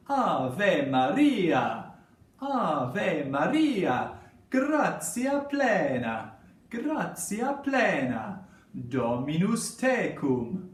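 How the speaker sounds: playful, melodic Italian accent. Shown as a demonstration. Teacher getting pupils to practise Latin words, Italian accent. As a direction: say the Latin in your best Italian voice. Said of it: "with a little Italian accent".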